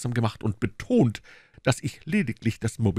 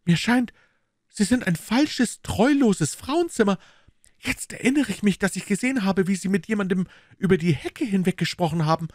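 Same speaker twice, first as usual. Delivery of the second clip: higher, louder startled lady of the house; surprised lady; 11 semitones higher, 3 dB louder than usual. Acted. Lady of the house startled at her maid, higher voice.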